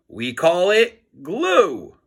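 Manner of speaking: deadpan slow. Acted. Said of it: "said Harold".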